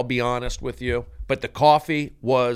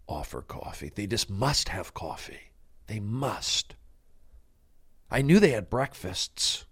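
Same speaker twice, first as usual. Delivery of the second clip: low, sinking into whisper; hammed-up hushed soliloquy; mock-dramatic hush; 5 semitones lower, 8 dB quieter than usual. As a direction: fade to a halting whisper, milking the drama. Continues the mock emotional scene.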